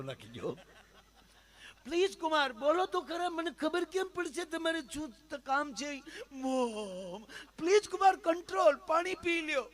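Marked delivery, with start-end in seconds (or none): 1.8-6.0 very high, anxious pleading mother; pleading mother-in-law. Acted. Mother-in-law pleads with silent son-in-law in high anxious voice. 6.3-9.7 very high, loud frantic plea; frantic mother-in-law. Acted. Mother-in-law urges sobbing son-in-law to drink water.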